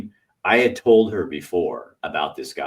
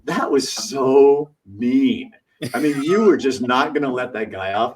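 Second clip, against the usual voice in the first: 1 mock scolding wife; 7 semitones higher, 5 dB louder, slower than usual.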